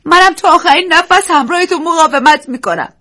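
high loud vow; resolute. Acted. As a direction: pledge with determination. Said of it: "دیزی از جا برخاست و گفت". (عهد بلند و مصمم)

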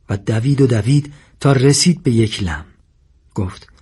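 ordinary delivery, nothing marked

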